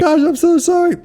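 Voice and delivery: high falsetto mock sob; hammed-up crying child voice; crying kid. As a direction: wail it like a sobbing guilty kid. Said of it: "like, crying".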